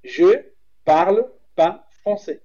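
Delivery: slow, careful je parle pas français; model French. Shown as a demonstration. Teaching cohost the phrase, slow careful French. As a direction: pronounce it slowly for learners. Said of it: "Sacou aí?".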